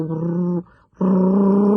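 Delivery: low rolled hrr at the bottom of the voice; lowest part of range. Shown as a demonstration. Coach showing the flutter from the lowest part of the range. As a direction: start the flutter as low as you can go. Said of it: "the lowest part of your range".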